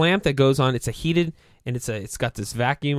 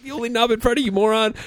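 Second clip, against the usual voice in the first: high-pitched retold jab at an idiot; mock exasperation; 10 semitones higher, faster than usual. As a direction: imitate a fed-up coworker, pitched up. Retold exasperated reply through laughter.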